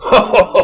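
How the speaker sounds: loud, high ho ho ho laugh; big braying laugh at the others; strident laugh. A narrator's reading. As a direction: bellow a mocking strident laugh. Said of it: "Armitage laughed a strident laugh".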